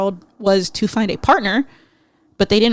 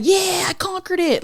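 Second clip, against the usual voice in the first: mock brag; 10 semitones higher than usual. Mocking a bragging dater.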